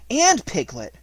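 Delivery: high, loud discovery; excited. A narrator's reading. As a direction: pipe up with growing delight. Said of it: "said Chris Robin excitedly".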